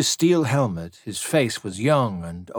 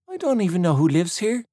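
put-on much higher, wide-eyed girl voice; innocent girl; 9 semitones higher than usual. A narrator's reading. Girl to the tan, high and innocent. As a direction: play innocent.

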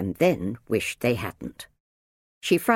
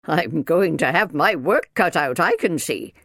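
louder, raised, put-upon minder; snorting disapproval; 5 semitones higher, 5 dB louder than usual. A narrator's reading. Minder sizes up her new job, snorting disapproval. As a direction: grumble it loudly at a raised pitch. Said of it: "snorted the minder".

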